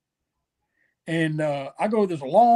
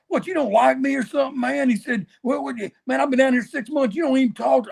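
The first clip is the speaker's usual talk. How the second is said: higher-pitched imitation of a wounded veteran; retold grievance, pitched up; mock offended; 7 semitones higher than usual. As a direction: act out his hurt complaint, lightly. Tommy Rich feels snubbed.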